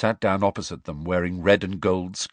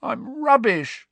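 slow, high-pitched despairing croak; drawn-out high croak; slower than usual. Acted. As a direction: croak it out in nervous misery. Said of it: "croaked Ron".

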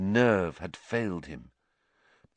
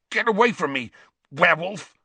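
higher, louder, gasping in pain; gasped; 8 semitones higher, 6 dB louder, faster than usual. A narrator's reading. Ron in pain recoils from Lupin, gasping and higher. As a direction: gasp it out, recoiling. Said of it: "Ron gasped".

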